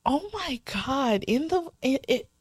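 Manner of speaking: slow, halting, scandalized disbelief; hammed-up shock ending near laughter; mock shock. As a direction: stammer it out, aghast, voice rising. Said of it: "I'd just be shocked".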